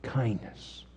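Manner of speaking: hushed word. Real, not acted. Closing word of the thought.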